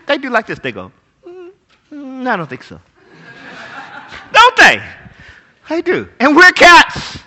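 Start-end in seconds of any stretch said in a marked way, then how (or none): 1.2-2.8 snooty cat. Retold or imitated. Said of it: "you know what cats do".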